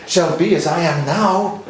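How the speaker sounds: coach's forceful demo of a line; passionate, driven. Shown as a demonstration. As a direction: drive the verse through with force. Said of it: "Push it, drive it".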